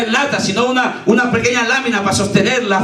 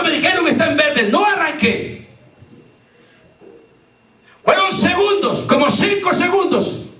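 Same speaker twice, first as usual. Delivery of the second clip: full-force preaching; 9 semitones higher than usual. Acted. Preacher testifying to the congregation, full-force preaching. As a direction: preach it out with rising fervor.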